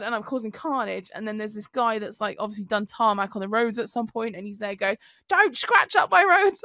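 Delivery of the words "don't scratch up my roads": high, loud, drawn-out complaint; mock angry roadworker. Tarmac man telling off driver, mock angry. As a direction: imitate an indignant yell, slow and raised.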